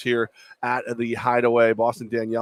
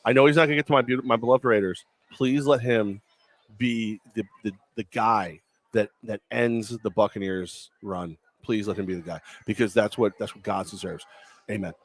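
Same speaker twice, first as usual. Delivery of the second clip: a half-joking Sunday prayer; mock prayer; 4 semitones lower than usual. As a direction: pray in jest, hushed and reverent. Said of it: "I'm gonna do a quick prayer".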